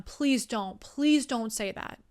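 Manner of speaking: normal talking voice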